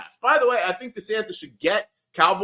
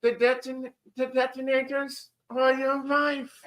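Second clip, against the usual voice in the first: hammed-up German villain, pitched higher; Hans Gruber; 4 semitones higher, 3 dB quieter, slower than usual. Villain taunting John McClane, hammed-up German accent. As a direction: threaten like a German movie bad guy. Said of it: "the, uh, the German accent".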